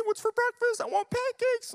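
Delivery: put-on little girl voice. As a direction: play a carefree five-year-old who forgot everything. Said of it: "She was like".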